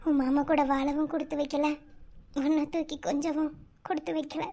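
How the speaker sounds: soft, sorrowful confiding; wistful and subdued. Acted. Aunt with three months left confides in baby, softly wistful. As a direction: speak quietly to the baby with resignation. Dying aunt's private wistful talk.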